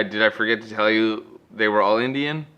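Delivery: ordinary talk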